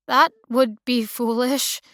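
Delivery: a touch of offended pride, slowed down; Lyndon, stranded, takes offence at the boyfriend guess: mildly offended. A narrator's reading. Stranded woman bristles at the stranger's assumption, offended and slow. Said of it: "offended that he would".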